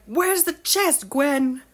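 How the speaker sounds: slow, high, mock-angry demand; mad demand. Mister Marley demanding the chest from Gwen, mock angry. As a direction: play the angry visitor, dragging it out. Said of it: "She was so mad".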